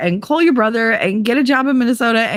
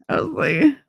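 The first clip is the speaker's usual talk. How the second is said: ordinary delivery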